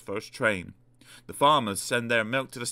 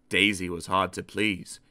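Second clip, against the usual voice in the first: ordinary delivery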